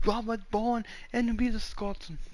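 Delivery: put-on high-pitched Scottish accent; Scots gent. Man demonstrating Scottish, Scots gent accent. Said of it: "Like a Scots gent".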